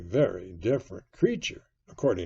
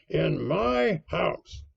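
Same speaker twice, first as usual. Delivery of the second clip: loud, high landlord barking orders; raised, commanding voice; indignant cry; 10 semitones higher, 7 dB louder than usual. A narrator's reading. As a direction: take command of the room, outraged. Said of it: "cried Silver".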